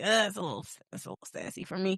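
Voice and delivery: soft mock-sassy aside, fast and fading; mock sassy woman. The date mimicking women he dated, faintly sassy. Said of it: "I was like".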